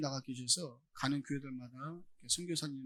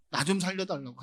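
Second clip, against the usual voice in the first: pleading out loud, louder; louder plea; 2 semitones higher, 7 dB louder than usual. Acted. Preacher voicing Jesus begging to be saved, loud pleading. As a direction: raise the plea into a loud cry.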